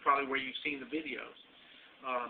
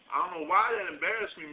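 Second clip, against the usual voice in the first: usual speaking voice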